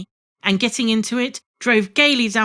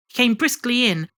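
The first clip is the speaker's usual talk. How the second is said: ordinary delivery, nothing marked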